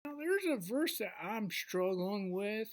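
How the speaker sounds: ordinary talk, nothing marked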